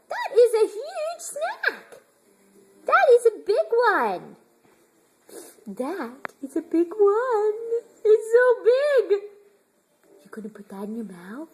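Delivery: usual speaking voice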